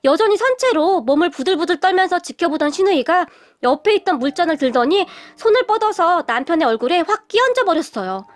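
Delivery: usual speaking voice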